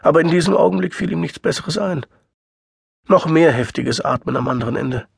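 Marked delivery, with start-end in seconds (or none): none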